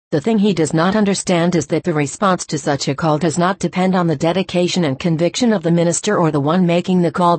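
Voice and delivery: ordinary talk, nothing marked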